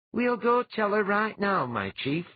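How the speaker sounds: keen to run off right away; enthusiastic. A narrator's reading. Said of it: "replied enthusiastically".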